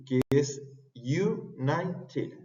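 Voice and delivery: "united" drawn out, yoo-NAI-ted; slow model word. Shown as a demonstration. Teacher slowing down for students, "united". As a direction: stretch "united" slowly.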